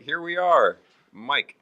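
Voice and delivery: lightly voiced old grandpa signing off, pitch up; a mock old man's sign-off. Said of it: "Be like".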